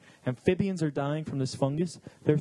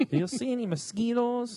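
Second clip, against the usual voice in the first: put-on higher-pitched joke Spanish accent; mock Spanish accent; 8 semitones higher than usual. Skeeter eater asking about mosquitoes, mock Spanish accent. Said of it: "They have Spanish accents".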